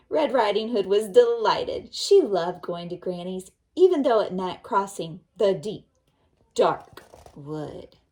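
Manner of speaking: put-on lively children's story narration. A narrator's reading. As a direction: tell the story with energy to kids. Teacher continues narration.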